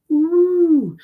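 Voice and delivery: high whooping cheer; a squealed, high woo; excited woo. Real, not acted. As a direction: let out a delighted whoop. Cheer after eye contact joke.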